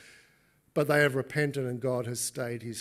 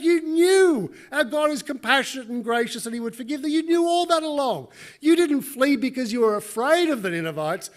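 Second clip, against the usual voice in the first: high, loud, rebuking preacher voice; scolding storyteller; 11 semitones higher, 11 dB louder than usual. Acted. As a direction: hammer it at Jonah like a rebuke.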